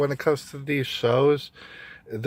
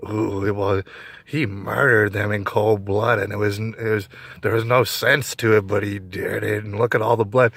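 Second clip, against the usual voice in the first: creepiest sounding lady; 3 semitones lower than usual. Retold or imitated. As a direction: relish the blood like a ghoulish storyteller. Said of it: "I swear that's how the, the lady sounds".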